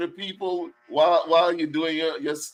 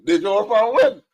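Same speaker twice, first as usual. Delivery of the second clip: excited; 8 semitones higher, 7 dB louder than usual. Real, not acted. Repeating guest's name delighted.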